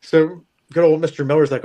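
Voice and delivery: ordinary delivery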